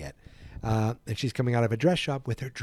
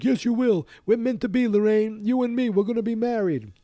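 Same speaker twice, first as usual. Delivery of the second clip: much higher, louder mocking yell; loud bully voice; 12 semitones higher, 5 dB louder than usual. Biff shouting mock.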